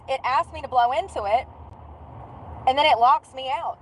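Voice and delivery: ordinary delivery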